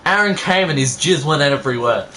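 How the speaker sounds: ordinary delivery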